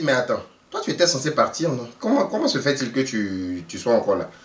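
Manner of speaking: ordinary talk, nothing marked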